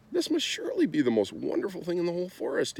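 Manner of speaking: high, fast and thrilled; excited cry. A narrator's reading. Prince delighted by the schnoozle, high excited cry. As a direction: gasp it out as if he's found a treasure. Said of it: "he cried".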